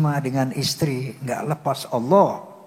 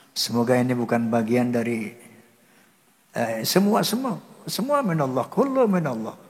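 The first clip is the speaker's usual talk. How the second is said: ordinary talk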